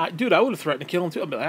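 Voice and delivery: ordinary delivery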